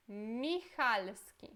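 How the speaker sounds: slow Michalski with ch as a throaty h; Polish ch. Shown as a demonstration. Teacher showing CH like a double R, slow. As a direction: draw out Michalski. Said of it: "Esse C H tem som de R".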